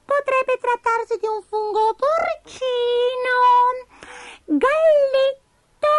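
very high, drawn-out list; tiny squeaky creature pondering; Abigail the little spider. A narrator's reading. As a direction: stretch each item in falsetto. Spider lists kinds of mushroom.

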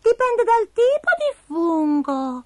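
very high, slow musing; tiny squeaky know-it-all; falsetto, words stretched out; Abigail the little spider. A narrator's reading. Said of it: "rispose Abigail".

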